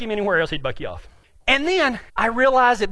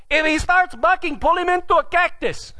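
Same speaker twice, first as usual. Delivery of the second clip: higher-pitched called-out advice; mock yell; 4 semitones higher than usual. Partner advising rider about bucking, mock yell. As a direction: imitate hollering instructions from afar. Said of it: "And he yells".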